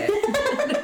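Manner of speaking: squealing burst of laughter; real high-pitched laugh. Real, not acted. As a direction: shriek with laughter. Comparing crying stories.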